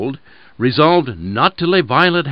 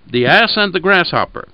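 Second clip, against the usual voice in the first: plain reading voice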